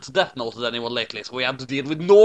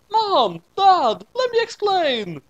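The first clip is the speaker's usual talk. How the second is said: put-on high, louder child's call; 6 dB louder, much slower than usual. Kid calling to Mom and Dad for attention, high and louder.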